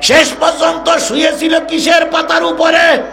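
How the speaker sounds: high-pitched, rousing sermon delivery; impassioned preaching. Acted. Preacher telling the crowd of the judge's downfall, high rousing declamation.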